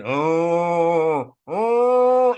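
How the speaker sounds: loud rising moans of the damned; moaning damned. Acted. People in hell moan in torment, loud pained groans.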